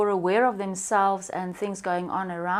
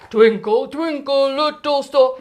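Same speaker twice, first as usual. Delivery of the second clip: loud, booming daddy singing voice; loud fatherly sing-song; like Daddy would; 4 semitones higher, 8 dB louder than usual. Shown as a demonstration. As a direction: sing it big and loud like a dad. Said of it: "like Daddy would".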